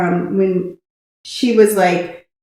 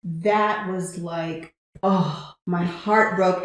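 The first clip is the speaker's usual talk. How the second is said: normal talking voice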